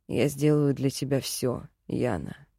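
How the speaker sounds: low, tender man's voice; devoted male elf. A narrator's reading. Elf promising everything to his beloved, low and tender. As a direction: pitch well down for a sincere pledge. Elf pledges himself to her.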